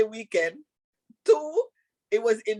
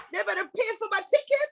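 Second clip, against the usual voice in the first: mock indignation; 9 semitones higher, faster than usual. Real, not acted. Mock outrage at Dubai costs.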